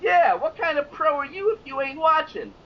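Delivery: mock a clueless pro; fake New York accent. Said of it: "giving this fake New York accent".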